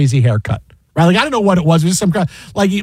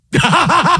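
high staccato cackle; imitated cackle; 12 semitones higher than usual. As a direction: imitate a man cackling helplessly at himself. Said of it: "he just cackles and turns bright red".

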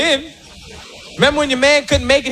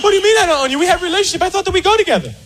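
high indignant woman; 7 semitones higher, much faster than usual. Retold or imitated. Woman protesting boyfriend.

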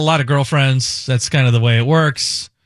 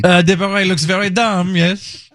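higher mock French accent; bad French accent; 4 semitones higher than usual. Host judging his own beret, bad French accent. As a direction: play a disapproving Frenchman, hammed-up accent. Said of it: "this bad French accent".

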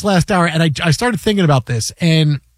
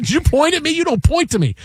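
higher, indignant mock scolding; retold wife in labour scolding her husband, raised pitch; 9 semitones higher than usual. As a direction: tell him off for pointing. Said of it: "She goes".

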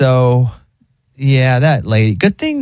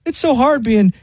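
high, sighing Big Bird; 9 semitones higher than usual. Retold or imitated. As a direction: whine it like a weary Big Bird. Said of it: "Big Bird's like".

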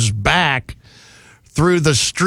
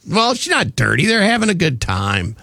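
ordinary delivery

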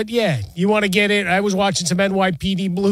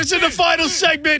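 high mock parent scolding; yelling parent; 9 semitones higher than usual. Parent scolding a caller during General Hospital, mock yelling. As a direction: imitate a parent fussing at an interruption. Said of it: "I get yelled at".

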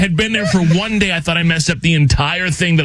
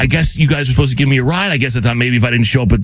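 rushed retelling; 5 semitones lower, faster than usual. Retold or imitated. Retold rushed explanation to clerk.

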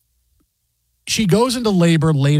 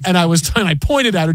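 normal talking voice

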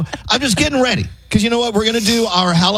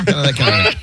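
evil laugh voice; 7 semitones lower than usual. Acted. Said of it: "It's like an evil laugh".

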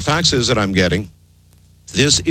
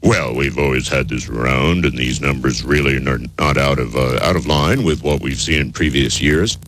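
low, droning expert voice; mock scientist; 11 semitones lower than usual. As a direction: play a dismissive scientist in a deep monotone. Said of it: "some scientists who are gonna say".

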